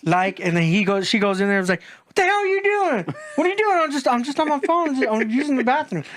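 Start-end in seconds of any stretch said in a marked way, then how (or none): 2.1-3.8 high-pitched imitation of an angry partner; mock outraged demand. Wife walking in on her man, mock high-pitched outrage. 3.8-6.0 high, stammered imitation of caught man; mock defensive excuse. Man caught on his phone by his wife, mock flustered excuse.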